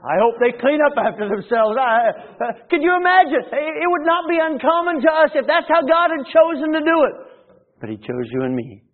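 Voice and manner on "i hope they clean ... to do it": much higher, louder incredulous preaching; tickled preacher climbing high; amused exclamation. Acted. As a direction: marvel at the absurd image. Preacher laughs at image.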